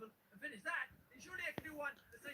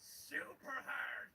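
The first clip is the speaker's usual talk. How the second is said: slow, heavy super hard; drawn out; 2 semitones lower, much slower than usual. Shown as a demonstration. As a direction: drag out super hard like it hurts. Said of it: "that's how I feel while I play it".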